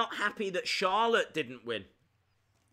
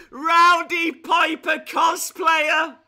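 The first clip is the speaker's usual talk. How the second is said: loud, high, slow delighted shout; excited shout; 10 semitones higher, 10 dB louder, slower than usual. Real, not acted. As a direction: yell the line in glee. Shouts line after laughing.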